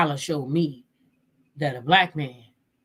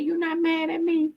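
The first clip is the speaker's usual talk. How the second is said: high voice for You not mad at me; mimicking wife; 11 semitones higher than usual. Vlogger voicing the wife asking the mistress, high and wondering. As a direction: pitch the wife's voice up high in disbelief. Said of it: "She said".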